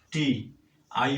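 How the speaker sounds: usual speaking voice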